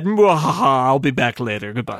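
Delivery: hammed-up evil laugh falling into a farewell; mock villain. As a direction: gloat like a cartoon villain, then drop away. Mocks a stock villain exit.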